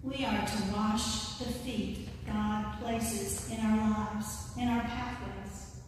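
ordinary talk, nothing marked

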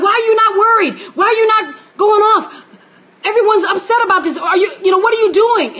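mock alarm. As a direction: play the panicked onlookers, half shouting. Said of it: "And everyone's like".

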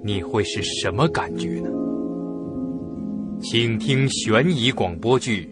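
usual speaking voice

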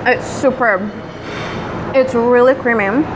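chewing between words; speaking around a bite; mouth full of food. Real, not acted. Seen 0: Took a bite at -5; hand covers chewing mouth while talking.

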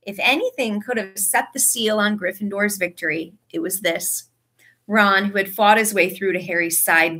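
plain reading voice

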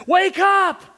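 high, loud frantic cry; panicked shout. Retold or imitated. Fishermen in the storm yell at Jesus, frantic. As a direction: shout him awake in terror.

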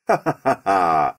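acted burst of laughter; king's laugh. A narrator's reading. King laughing at the whole mess, acted laugh. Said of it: "és felnevetett".

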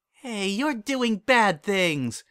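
high, slow, eager puppy voice; excited dog voice. Acted. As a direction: play a happy dog, drawn out and high.

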